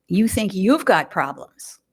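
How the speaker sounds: ordinary talk, nothing marked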